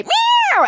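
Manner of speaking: very high yowl; cat meow. Acted. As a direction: screech like a cat. Cat cries in sack.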